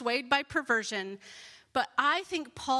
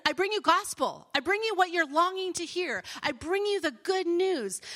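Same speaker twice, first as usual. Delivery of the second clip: higher, louder glad announcement; excited proclamation; 6 semitones higher, 4 dB louder than usual. Acted. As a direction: herald long-awaited news with excitement. Rewords angel's announcement excitedly.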